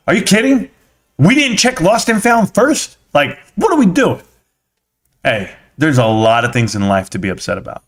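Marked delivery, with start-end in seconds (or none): 0.1-4.2 high-pitched exasperated disbelief; worked-up indignation pitched way up; furious, retold. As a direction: retell it in high, incredulous outrage. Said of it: "And I'm furious".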